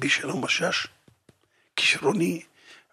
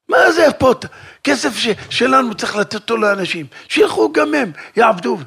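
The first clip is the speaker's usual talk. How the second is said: high, loud protest; heated argument; 5 semitones higher, 6 dB louder than usual. Acted. In the quarrel, insisting the money go to people, loudly. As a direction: push the point hard, voice raised.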